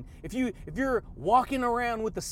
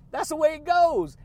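louder and much higher, falling at the end; belted catchphrase; 7 semitones higher, 5 dB louder than usual. Real, not acted. Vlogger belting his catchphrase to viewers. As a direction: belt it like a sign-off.

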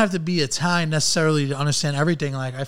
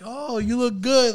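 mock compliment; 7 semitones higher, slower than usual. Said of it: "when someone's talking about you".

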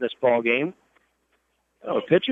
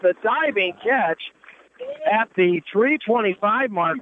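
excited call; 10 semitones higher than usual. Real, not acted. Home run call, diving catch.

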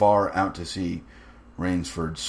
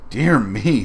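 lightly amused composure, louder and higher; mock-mild exclamation, unruffled; quite unruffled; 5 semitones higher, 9 dB louder than usual. A narrator's reading. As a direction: brush it off with genteel composure. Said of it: "quite unruffled".

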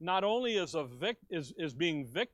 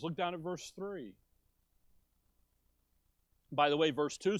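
usual speaking voice

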